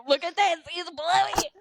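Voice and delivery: high, delighted; excited. Retold or imitated. Texting Radley about the Bluey costume, high excited. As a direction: gush over a toddler in costume. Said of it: "I was like".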